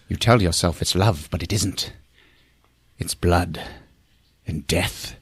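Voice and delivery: low, soft, ominous pronouncement; creepy seducer. Retold or imitated. Paul lecturing the woman on desire, creepy seducer. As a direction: let it sink low with dark pauses.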